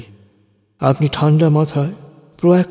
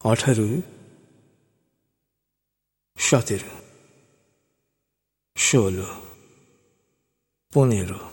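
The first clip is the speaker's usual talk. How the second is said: slow hypnotist voice; 2 semitones lower than usual. Acted. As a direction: count down slowly to put them under. Slow hypnotic countdown to zero.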